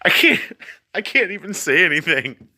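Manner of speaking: can't keep a straight face; cracking up. Real, not acted. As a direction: voice jumps high, breaking into laughter. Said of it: "I tried to keep a straight face for this. I can't do it".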